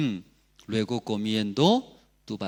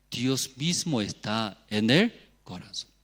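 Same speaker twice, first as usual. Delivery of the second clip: normal talking voice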